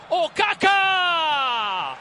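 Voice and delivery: long goal call; drawn-out goal shout. Real, not acted. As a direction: stretch the name out.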